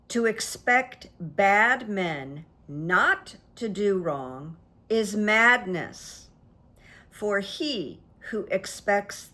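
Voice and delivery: slow, careful quote reading; reading aloud haltingly. Shown as a demonstration. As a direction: read each phrase slowly and deliberately. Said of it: "I'm gonna read it slowly".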